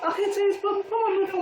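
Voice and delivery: high-pitched imitation of a hysterical caller; imitated hysterical crying. Sister refusing to tell on the phone, mock-hysterical. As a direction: mimic her upset, voice way up. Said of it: "If you crying hysterical".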